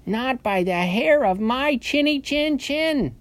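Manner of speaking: high, slow squeaky pig refusal; little pig voice. A narrator's reading. Frightened little pig refuses wolf.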